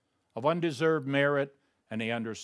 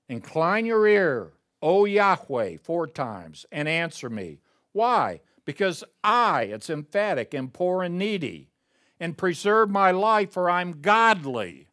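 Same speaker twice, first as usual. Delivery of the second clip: louder, raised pitch, proclaiming; preaching at full force; 3 semitones higher, 6 dB louder than usual. Acted. Preacher proclaiming David's plea to the congregation, loud. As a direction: call the psalm out to the whole room.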